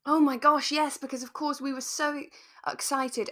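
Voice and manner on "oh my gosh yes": normal talking voice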